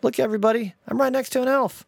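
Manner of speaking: hammed-up dad shouting to passers-by; yelling out the window. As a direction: call it out to the whole street, teasing. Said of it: "He starts yelling out the window".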